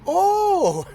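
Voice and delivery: very high, loud gasp of recognition; the penny drops all at once; sudden realization. Retold or imitated. Daughter finally recognizing the place, a loud high gasp.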